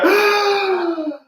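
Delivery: high, drawn-out shocked gasp; mock gasp. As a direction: gasp like a client seeing themselves. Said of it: "They go".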